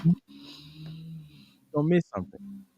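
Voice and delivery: muffled, as through a wall. Real, not acted. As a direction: voice dropping out on a bad line. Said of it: "It sound like you muffled".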